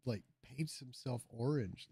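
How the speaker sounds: ordinary talk, nothing marked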